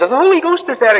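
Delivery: very high Scottish imitation; little Scottish voice. Clergyman answering the young preacher, high little Scottish voice. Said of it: "in his little Scottish voice".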